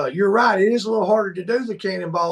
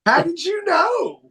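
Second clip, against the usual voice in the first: mock amazement; 8 semitones higher than usual. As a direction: gush like he read your mind. Mock amazement at Tavin's insight.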